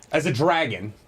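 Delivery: ordinary delivery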